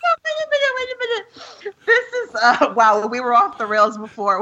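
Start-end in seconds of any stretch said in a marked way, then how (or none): 0.1-2.3 laughing. Real, not acted. Laughing mock protest.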